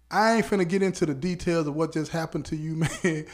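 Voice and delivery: real chuckle breaking into the words; amused, laugh escaping mid-sentence; laughing while talking. Real, not acted. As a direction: try to get it out through a laugh. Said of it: "I'm laughing now".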